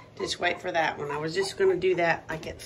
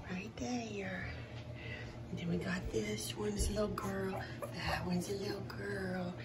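soft hushed whisper; 9 dB quieter than usual. Real, not acted. Pointing out each pup, soft whisper. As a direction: keep it barely audible. Whispering over newborn puppies.

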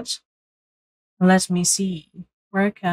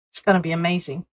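ordinary delivery

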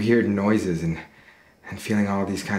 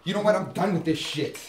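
raised, high-pitched exasperation; angry, fed up; 10 semitones higher than usual. Acted. Man walking out on Carol, angrily fed up. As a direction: declare it finished in heated anger. David breaks off the quarrel.

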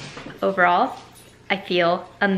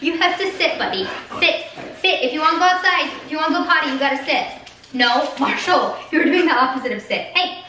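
high coaxing dog-owner voice; pet voice to dog; 8 semitones higher than usual. Real, not acted. Telling her dog Marshall to sit, high coaxing pet voice. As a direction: coax the puppy, pitched up.

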